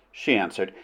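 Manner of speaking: ordinary delivery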